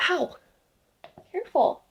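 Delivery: sudden high yelp of ow; startled ow. Retold or imitated. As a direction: yelp it as if bitten. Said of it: "my guides were like".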